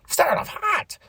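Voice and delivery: high-pitched excited squeak; amazed reaction. Retold or imitated. Repeats own amazed reaction.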